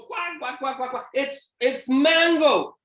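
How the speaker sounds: kids mocking the pastor. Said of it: "Then they go".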